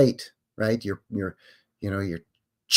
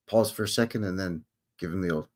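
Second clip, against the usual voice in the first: ordinary talk, nothing marked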